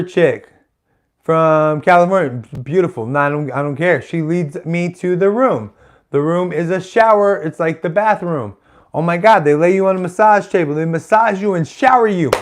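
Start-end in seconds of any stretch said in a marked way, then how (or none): none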